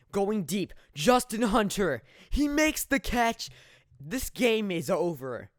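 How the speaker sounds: loud, high, drawn-out shouts; triumphant sportscaster yell; excited game-winning call. Real, not acted. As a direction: belt out the winning catch, slowing for the finish. Calling the overtime winning catch.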